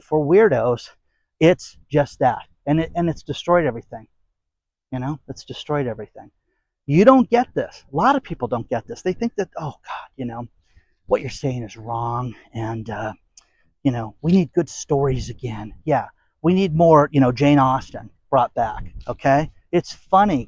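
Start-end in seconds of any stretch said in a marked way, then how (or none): 4.9-6.3 "destroyed" said again, much softer; quiet echo. Real, not acted. Repeated complaint, quieter echo. 9.6-15.7 mock critics. Mocking critics' objections.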